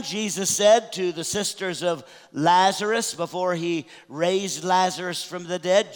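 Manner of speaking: ordinary talk, nothing marked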